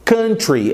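country said the English way, KUN-tree; English pronunciation. Shown as a demonstration. Teacher modeling country for students, English KUN-tree. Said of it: "pronunciar bonitinho essa palavra".

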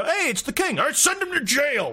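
high, lightly voiced pompous king. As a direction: toss off the order like a careless king. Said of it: "where you go".

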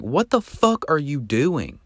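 mock scream, high and drawn out, no real volume; high-pitched imitation of yelling; retold startled scream. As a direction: replay a startled outburst, pitched high and slow. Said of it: "So I screamed at him".

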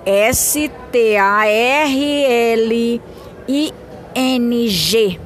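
letters spelled one by one, slowly; spelling it out. Shown as a demonstration. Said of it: "eu vou assoletrar também".

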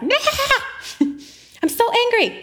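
high, drawn-out mock tantrum wail; raging toddler. Toddler refusing to be reasoned with, mock raging. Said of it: "If they are upset and raging".

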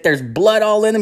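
high-pitched insistent comeback; acting out an indignant cook defending himself; retold defensive retort. Cook argues back at the yelling.

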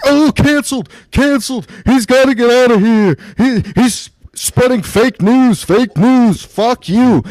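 high-pitched mocking hecklers; mock outrage. Comic mimicking people cancelling Norm, high mock outrage. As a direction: pitch it up like an indignant mob.

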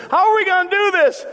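high, loud mock alarm; panicked reaction. Preacher acting the church's panicked reaction to a financial step, high and loud. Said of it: "Our first reaction sometimes is".

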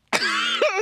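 squealing laugh; high shrieking laugh. Real, not acted. Laughing fit.

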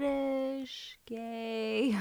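slow soft teasing jab; drawn-out cheeky joke; slow teasing aside. Pretend. Joking aside.

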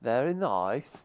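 slow, drawn-out mock British; mock British accent. Co-host aping the comic's attempt, slow mock British accent. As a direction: stretch it out in imitation British. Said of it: "Chris is really good at impersonation".

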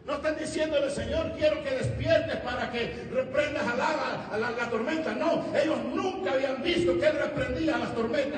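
high, driving, emphatic sermon delivery; preaching. Acted. Preacher explaining why the disciples wake the Lord, forceful preaching. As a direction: hammer the point home to the congregation.

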